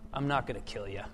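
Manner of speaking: low, soft, understated superhero voice; a half-hearted go at a hushed, deep hero; Batman. Acted. Batman answers the thug he holds, lightly voiced low growl. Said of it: "can't really do the Batman voice".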